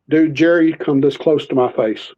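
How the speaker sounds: ordinary delivery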